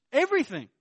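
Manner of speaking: loud and high on the one word; emphatic shout. Real, not acted. Preacher driving home his point to the congregation, loud. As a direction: shout it as the punchline.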